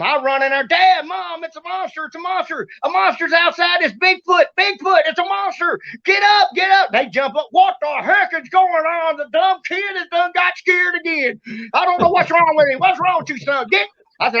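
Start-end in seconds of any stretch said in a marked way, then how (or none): 0.7-6.9 high-pitched urgent cries, repeated; retold panicked alarm. Boy waking Dad and Mom about a monster, acted panic. Child raising alarm to sleeping parents. 7.5-13.9 high-pitched fussing, acted out; retold exasperated parent. Parent woken by the scared kid, acted exasperation.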